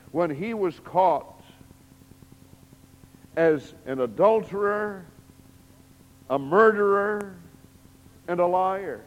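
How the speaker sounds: loud, high building preaching; preaching at full force. Real, not acted. Preacher building David's guilt, loud and rising. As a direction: build to the accusation.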